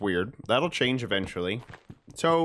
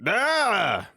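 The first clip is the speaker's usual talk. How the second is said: startled yelp; 6 semitones higher than usual. Real, not acted. Startled by something in game.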